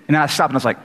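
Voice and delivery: ordinary delivery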